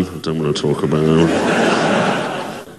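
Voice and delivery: low, slow, drawn-out grumble; sulky refusal. Acted. Jesus back home after failing, low sulky drawl. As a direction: drag it out in a mock sulk.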